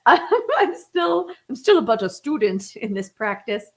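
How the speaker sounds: a self-mocking giggle as she admits it; laughing. Real, not acted. Laughs at own shortcomings.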